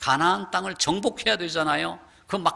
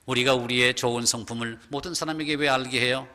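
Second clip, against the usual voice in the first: ordinary talk, nothing marked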